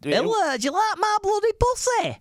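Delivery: very high, hammed-up Hull woman; Hull accent. Host mimicking drunk lap dancer, hammed-up Hull accent. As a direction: squeal it in exaggerated mock Hull. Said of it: "a girl with that accent from Hull".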